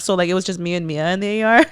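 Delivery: normal talking voice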